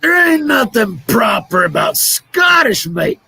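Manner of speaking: loud, high put-on Scottish accent; mock Scottish outrage. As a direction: protest like an indignant Scot.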